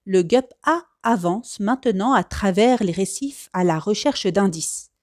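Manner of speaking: ordinary delivery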